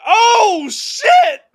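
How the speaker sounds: high, loud oh shit; retelling freaking out at the birth, a loud high yell; panicked yell. Retold or imitated. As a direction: shout it in panic. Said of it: "And I was like".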